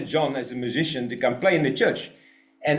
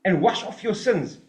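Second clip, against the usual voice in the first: higher, pressing call to baptism; urgent plea; 7 semitones higher than usual. Retold or imitated. Preacher voicing Jesus to the crowd, higher and urgent. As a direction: urge them, raised and pressing. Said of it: "he says".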